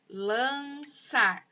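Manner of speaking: "lançar" drawn out as LAN, ÇAR; stretched, divided "lançar"; syllable by syllable. Shown as a demonstration. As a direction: sound out "lançar" in two separate beats. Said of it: "Duas sílabas".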